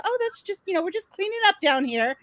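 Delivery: high, light brush-off voice; breezy nurse. Retold or imitated. Nurses dodging a new mother's question, breezy high imitation.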